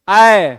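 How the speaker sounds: ऐ drawn out and loud; demonstrated vowel. Shown as a demonstration. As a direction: stretch ऐ slowly for the class. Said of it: "आप बोल करके स्वयं देख सकते हैं".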